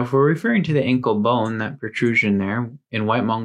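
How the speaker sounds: ordinary talk, nothing marked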